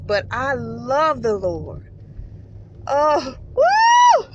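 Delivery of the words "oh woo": a sudden very high, very loud whoop of joy; joyful woo. Real, not acted. Worshipper overcome telling viewers about the Lord, ecstatic whoop. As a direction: let the excitement burst out in a shout. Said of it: "I'm getting excited".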